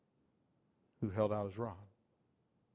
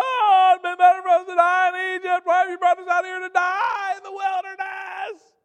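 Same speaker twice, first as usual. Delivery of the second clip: loud, high wailing complaint; whining Israelites; 14 dB louder than usual. Acted. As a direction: cry out like a desperate crowd.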